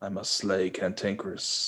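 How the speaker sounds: hushed, low-key hero's vow; lightly voiced monster slayer; mock fantasy hero. Cohost plays along with mythical beast joke, mock heroic.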